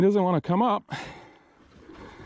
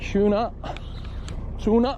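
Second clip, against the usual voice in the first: excited; 5 semitones higher, slower than usual. Real, not acted. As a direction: call it out, thrilled. Fish on the line.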